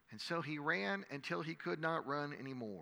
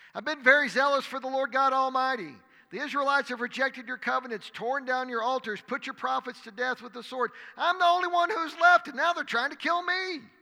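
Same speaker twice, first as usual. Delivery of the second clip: loud, much higher desperate complaint; anguished outcry; 10 semitones higher, 10 dB louder than usual. Acted. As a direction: pour out despair at full voice. Elijah complaining to God.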